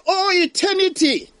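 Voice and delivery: high, loud anguished wail of a dying man; dying man's lament. Acted. Dying sinner wails about eternity, high and anguished. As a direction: cry out in dread of eternity. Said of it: "Ɔse".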